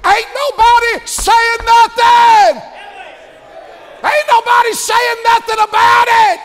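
high, loud, slow preacher's shout; preaching at full force. Acted. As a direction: bellow it from the pulpit, drawn out.

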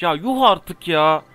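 loud, slow, fed-up protest; real frustrated jeer at the game; exasperated outburst. Real, not acted. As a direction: drag it out loudly in disbelief. Exasperated after dying in game.

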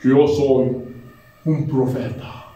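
low-pitched, halting, pompous imitation; self-important prophet. Preacher mimics a proud man introducing himself, self-important prophet voice. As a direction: announce yourself grandly, low and measured. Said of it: "Y así lo dijo así, literal".

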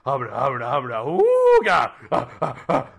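loud leering hubba hubba; lusty hubba hubba. Acted. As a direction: ogle and holler with lust. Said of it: "he's like".

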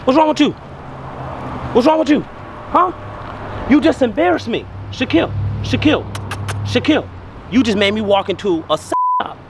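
high, exasperated telling-off; scolding. Real, not acted. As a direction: scold a misbehaving dog. Scolding dog for embarrassing him.

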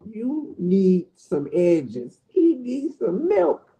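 slow, stretched teasing roast; drawn-out roast. Real, not acted. Roasting his friend's hairline, slow mocking drawl. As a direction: drag each word out mockingly.

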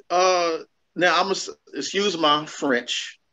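ordinary talk

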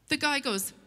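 ordinary delivery

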